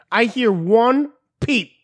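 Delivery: slow warning from a fat teacher; slow stern threat. Retold or imitated. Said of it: "was like".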